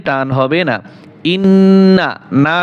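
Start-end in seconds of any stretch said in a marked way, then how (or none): 1.3-2.1 ইন্না with the doubled n held nasally, not stretched; nasal ghunnah. Shown as a demonstration. Teacher showing students ghunnah on ইন্না. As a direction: hum through the nn of ইন্না. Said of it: "গুণ হবে".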